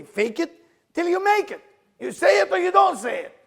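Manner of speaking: loud, high trainer's shout; retold screaming. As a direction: act out yelling a challenge at your trainee. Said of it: "screaming at each other".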